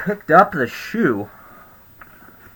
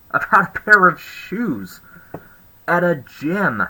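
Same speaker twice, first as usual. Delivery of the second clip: exasperated emphasis; 6 semitones higher than usual. Retold or imitated. Exasperated retort to Karen.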